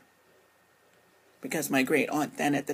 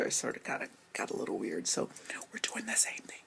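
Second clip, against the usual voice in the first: whisper; 8 semitones lower, 7 dB quieter than usual. Real, not acted. Confiding awkward family kissing.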